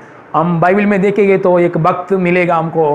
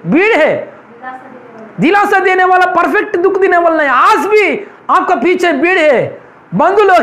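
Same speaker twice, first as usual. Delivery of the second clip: raised, high-pitched preaching; fervent preaching; 7 semitones higher than usual. Acted. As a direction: drive the point home to the congregation. Preaching with rising intensity.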